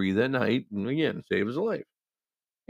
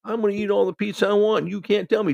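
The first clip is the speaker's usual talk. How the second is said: high, loud ranting; patient ranting at the dietitian; going off on her; 12 semitones higher, 4 dB louder than usual. Retold or imitated. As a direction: imitate a furious patient demanding pizza. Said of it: "starts going off on her again".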